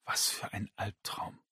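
breathy whisper. A narrator's reading. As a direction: whisper it under your breath. (geflüstert)

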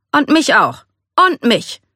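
high, eager chime-in; chiming in. A narrator's reading. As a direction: jump in to back your friend, pitch way up. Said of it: "stimmte Xian Po ein".

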